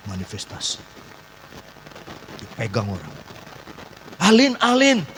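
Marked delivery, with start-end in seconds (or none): 4.2-5.1 high, urgent re-enacted call; raised-pitch acted calling; calling her name. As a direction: call out to the possessed woman. Imitates calling the possessed woman.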